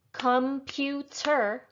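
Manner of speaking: computer said slowly as com-pu-ter, three even beats; syllable by syllable. Shown as a demonstration. Teacher showing learners the beats in computer, syllable by syllable. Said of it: "a syllable is like a beat".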